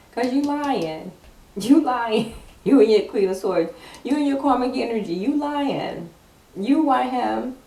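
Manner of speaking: teasing callout. Real, not acted. Reader scolds lying card, loud playful teasing. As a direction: catch her in the lie, laughing at it.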